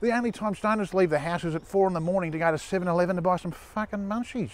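ordinary talk, nothing marked

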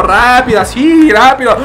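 urgent hurry. Real, not acted. Urging himself loudly.

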